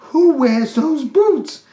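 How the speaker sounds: high, loud, slow amazed question; mesmerized. Retold or imitated. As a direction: blurt it out in drawn-out astonishment.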